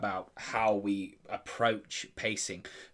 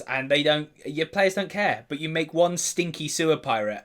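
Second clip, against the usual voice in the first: usual speaking voice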